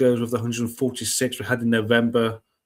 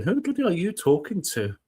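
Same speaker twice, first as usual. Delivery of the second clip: higher, indignant cat voice; voicing the cat; 7 semitones higher, faster than usual. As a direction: voice the grumpy cat's disbelief. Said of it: "Stormy was just looking at Seti going".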